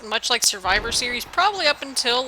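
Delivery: ordinary talk, nothing marked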